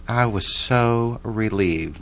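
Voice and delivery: usual speaking voice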